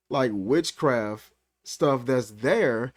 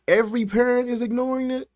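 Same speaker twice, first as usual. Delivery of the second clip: incredulous; 9 semitones higher, 3 dB louder, faster than usual. Real, not acted. Incredulous rhetorical question.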